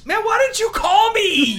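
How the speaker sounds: high, loud mock complaint; high-pitched comic shout; mock outraged yell. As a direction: holler it like a wronged buddy.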